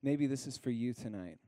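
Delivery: ordinary delivery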